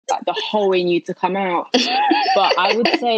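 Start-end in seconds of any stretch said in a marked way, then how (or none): 1.7-3.2 high, slow words through a laugh. Real, not acted. Wife admitting she's usually too tired, laughing. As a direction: laugh out the start of the line. Laughing while starting point.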